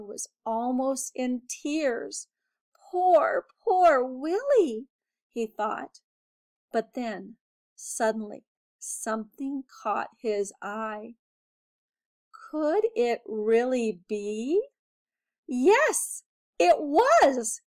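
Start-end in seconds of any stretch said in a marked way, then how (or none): 2.9-4.9 pitying. Acted. Alexander almost in tears. 15.5-17.6 high, loud thrilled cry; excited. Acted. Finds the purple pebble.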